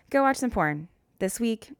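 ordinary delivery

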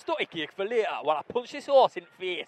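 put-on Geordie catchphrase voice; Geordie accent. Getting into the Geordie accent with a film line, put-on Geordie. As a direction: launch into broad, mock Geordie. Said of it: "That's how I get into my Geordie accent".

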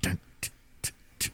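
breathy voiceless stammer; imitated gasping stutter. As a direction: sputter it out in short breaths. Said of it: "and then she goes".

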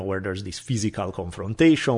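normal talking voice

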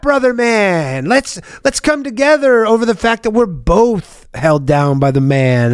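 slow, high, drawn-out hippie voice; hippie peacemaker. Retold or imitated. Naive guy befriending attacker.

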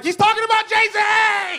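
high, loud, slow excited cry; excited shout. Retold or imitated. Comic telling audience, excited shouted recognition. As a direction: shout it like you finally get the joke.

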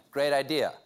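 normal talking voice

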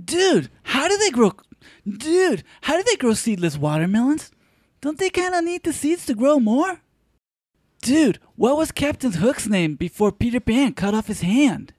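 high dude voice. Acted. Stoner character voice.

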